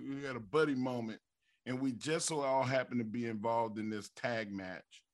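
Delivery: normal talking voice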